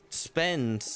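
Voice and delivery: normal talking voice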